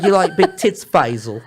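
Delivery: thicker cockney. Retold or imitated. Retelling the wife's line, now broader Cockney. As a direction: lay the Cockney on thicker each time. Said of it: "the more cockney she gets".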